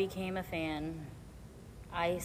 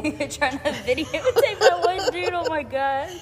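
high, loud laughing talk; 9 semitones higher, 11 dB louder than usual. Real, not acted. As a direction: laugh through the words. Laughing at drunk fan.

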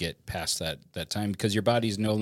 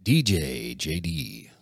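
slow, drawn-out radio DJ voice; slow radio-host delivery; much slower than usual. Pretend. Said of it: "[name] has a good D J voice".

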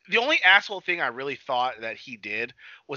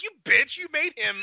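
very high-pitched mock indignation; high, incredulous mock scolding; mock outrage; 13 semitones higher than usual. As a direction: squeal it in laughing outrage. Mock outrage at character, laughing.

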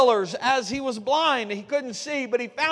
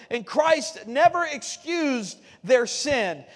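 normal talking voice

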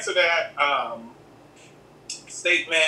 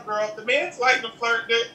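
ordinary talk

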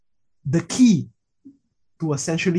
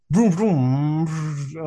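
engine vroom; much slower than usual. Retold or imitated. Imitating a gas guzzler for a friend, engine vroom.

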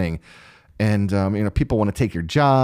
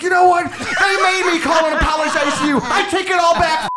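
high, loud hammed-up outburst; shouted, indignant retraction; mock furious shout; 5 dB louder than usual. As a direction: imitate an exasperated man blowing up on the phone. Acted outburst retracting phone apology.

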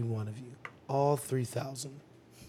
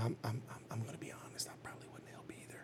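whispered confession, much softer; 5 semitones lower, 18 dB quieter than usual. Real, not acted. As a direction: admit it in a hushed whisper. Confessing quietly.

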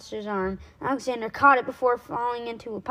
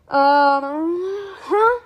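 high, loud, dismayed questioning; dismayed sigh; 9 semitones higher, 7 dB louder than usual. A narrator's reading. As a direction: open on a drawn-out, uneasy um. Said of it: "sighed Alexander".

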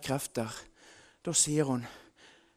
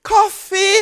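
loud, high call for coffee; shouted order; 13 semitones higher, 13 dB louder than usual. Retold or imitated. Guests calling out loudly for coffee. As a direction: bark out the order from the dining room. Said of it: "hører hun en stemme".

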